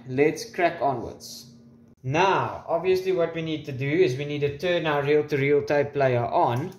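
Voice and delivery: normal talking voice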